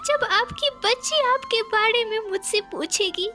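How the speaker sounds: high, hammed-up grieving wife; weeping bitterly. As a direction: wail and plead over a dead husband. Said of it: "फूट फूट कर रोने लगती है". (फूट-फूट कर रोती पत्नी)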